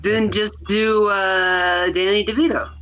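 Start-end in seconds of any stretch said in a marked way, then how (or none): none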